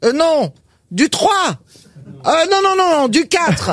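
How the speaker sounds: calling out with rising astonishment; growing excitement. Acted. Pharmacist corrects the order upward, escalating high call. Pharmacist keeps raising the size.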